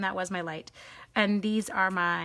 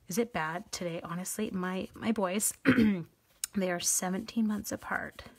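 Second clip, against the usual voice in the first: ordinary talk, nothing marked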